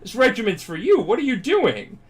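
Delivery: high-pitched exasperated questioning; acted exasperated disbelief; incredulous scolding. Acts mentor scolding collapsed trainee.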